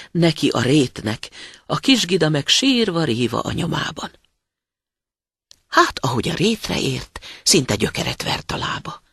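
ordinary delivery

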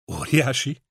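loud, bright burst of delight; enthusiastic. A narrator's reading. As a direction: throw it out with delight. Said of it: "lelkesedett Zeiler". (lelkes, hangos felkiáltás)

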